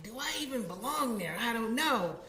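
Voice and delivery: mock-uncertain inner voice; acted self-doubt. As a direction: wonder aloud, retelling your own self-doubt. Retells own self-doubt before job.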